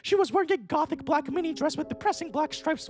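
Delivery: plain reading voice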